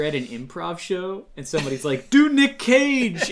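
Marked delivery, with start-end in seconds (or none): 2.1-3.3 high, loud, drawn-out heckle; shouted request. Acted. Improv audience member calls to the stage, mock shout. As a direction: yell it out like an audience suggestion.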